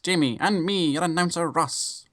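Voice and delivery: high, bright 1950s radio announcer; old-timey announcer voice. Pretend. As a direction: announce the show like an old newsreel. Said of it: "the old-timey announcer voice".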